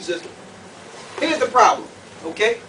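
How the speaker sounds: ordinary talk, nothing marked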